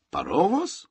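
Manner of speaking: high rising echo of a question. A narrator's reading. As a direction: parrot it back, voice climbing high. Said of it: "zapytał niczym echolarik". (pytające echo)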